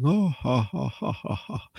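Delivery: high mock guffaw, tailing off; imitated belly laugh. Lieutenant busting out at the inmate's complaint, imitated belly laugh. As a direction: act out the lieutenant laughing really hard. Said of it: "You know, the real hard laughing".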